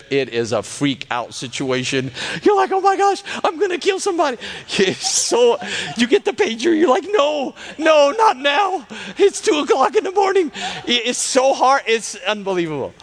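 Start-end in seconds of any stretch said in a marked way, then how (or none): 2.4-4.4 high-pitched, hammed-up freak-out; mock panic. Panicking intern in retold story. 7.1-10.5 high-pitched comic pleading; hammed-up whine of dread, pitched way up; mock dread. Intern dreading the pager call.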